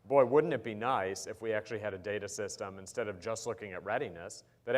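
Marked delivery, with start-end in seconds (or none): none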